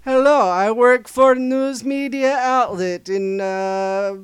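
slow put-on Russian accent, loud; deadpan foreign reporter voice, slow; fake Russian accent. Fake reporter calling a Nebraska paper, slow Russian accent. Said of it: "sir, you from Russia?".

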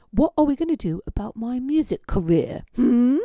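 high, loud, tight-lipped impression of him. Man confronting his date over dinner, mock tight-lipped voice. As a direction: say it through a very tight lip, mockingly. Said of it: "he said with a very tight lip".

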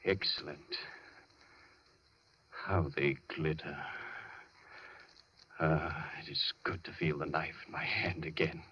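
hushed, gloating whisper; low whisper over the knife. Acted. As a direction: breathe it, savouring the blade. Doctor savours the knife.

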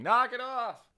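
high, loud comic yell; exploding in fake fury; sudden mock outburst. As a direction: snap into a shouted scolding. Mock outburst at the joke tellers.

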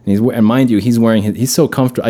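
ordinary talk

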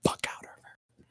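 hushed whispered threat; whispered aside. Retold or imitated. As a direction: breathe it low and secret.

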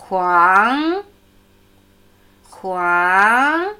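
"Huang" said slowly with its pitch climbing at the end; teacher demonstrating the rising tone of "Huang"; rising second tone. Shown as a demonstration. Teacher drills "Huang" with a clear rising second tone. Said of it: "Zweiter ansteigende Ton".

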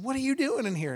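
high-pitched retold puzzlement; surprised question. Pastor finding girl on theater floor, surprised.

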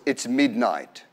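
low, quiet eerie voice; a hint of an eerie possessed woman; strange tone. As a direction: say it low and strange, like something else speaks. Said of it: "in a very strange tone of voice".

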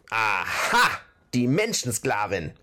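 scolding troll outburst; shrieky nagging troll. A narrator's reading. Troll chief hears of the troll woman, scolding. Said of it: "keifte er".

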